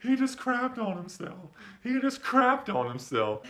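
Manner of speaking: high, slow giddy voice; mock Zach laughing. Zach on the floor tells everyone, high giggly squeal. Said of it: "laughing, saying".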